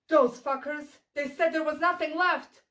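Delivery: loud, high-pitched angry shout; furious cry of indignation; angry outburst. Acted. Quoted outburst of character in poem.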